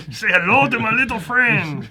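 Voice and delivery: bad movie gangster Cuban accent. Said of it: "That's the worst Cuban accent ever".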